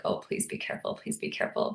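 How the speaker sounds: breathy whispered prayer for the deer; soft anxious whisper; whispered plea. Retold or imitated. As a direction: plead under your breath. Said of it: "I was like".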